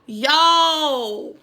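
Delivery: drawn-out high yo; stunned yo. Real, not acted. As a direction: let it ring out in disbelief. Exclaiming in disbelief.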